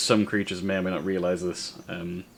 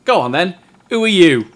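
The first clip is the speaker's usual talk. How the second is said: challenging demand; 8 semitones higher, 9 dB louder than usual. Acted. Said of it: "looks directly at you and says".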